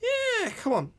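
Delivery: put-on very high, chummy old lady; feigned delight. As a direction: coax brightly in a squeaky-high voice. Ethel keeps up the act.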